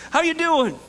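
high, loud friendly hail from the shore; friendly call. Retold or imitated. Jesus calls to the disciples from shore, bright and loud. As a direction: shout it cheerily across the lake.

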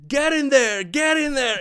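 imitating a laughing boy urging something on; giggly kid. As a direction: chant it like a kid cracking himself up. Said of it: "all he was saying is".

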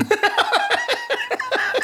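high real burst of laughter. Real, not acted. Recalling the gas station question, real high laugh.

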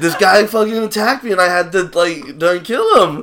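high, loud, laughing plea of a frantic woman; mock panicked woman. Woman in game asking for help.

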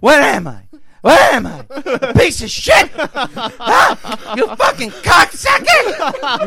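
loud, high-pitched furious yelling; onstage breakdown. Acted. Old comic losing it at a talking diner, enraged. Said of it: "he'd have the breakdown on stage".

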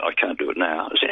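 usual speaking voice

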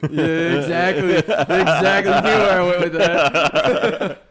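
laughing hard, slow and loud; barely getting words out through laughter. Real, not acted. Friend laughs over his own Russian bit, laughing hard.

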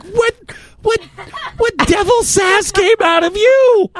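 high, disbelieving outburst; shocked. Retold or imitated. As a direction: sound stunned and amazed. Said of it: "and I'm like".

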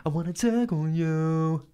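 high, slow crooning. Pretend. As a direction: croon it like an R and B singer. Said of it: "He has a very R and B voice".

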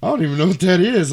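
mildly exasperated, high and loud; quick, high-pitched bafflement; baffled. Retold or imitated. Imitating co-host's confusion over the bug, baffled.

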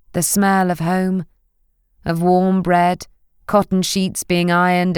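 ordinary delivery

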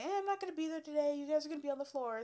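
breezy teacher voice. Retold or imitated. Teacher quoted announcing.

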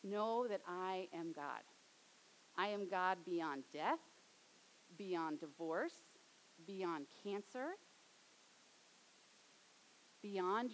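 steady the grieving widow slowly; slow tender voice of Jesus; gentle Jesus voice. Acted. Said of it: "he was able to communicate".

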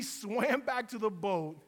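ordinary talk

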